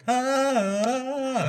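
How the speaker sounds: high sung robotic Ah. Retold or imitated. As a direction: sing it like a speech program. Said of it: "Trevor and I will always be like".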